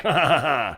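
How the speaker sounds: short laugh at own joke. Real, not acted. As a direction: laugh after the mocking line. Laughs at own mocking quote.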